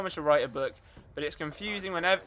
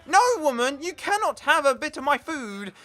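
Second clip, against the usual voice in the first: loud, high mock outrage; indignant refusal; 5 semitones higher, 4 dB louder than usual. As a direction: tell her off in hammed-up indignation. Acted quarrel over food.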